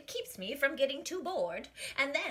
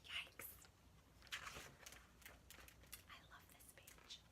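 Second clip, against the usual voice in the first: whisper; 21 dB quieter than usual. Real, not acted.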